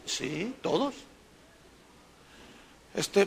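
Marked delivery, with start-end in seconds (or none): 0.1-1.0 soft, settled answer; calm yes. Real, not acted. Preacher answers the doubters, soft and calm. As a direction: quietly confirm it, no doubt left. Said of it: "así como diciendo".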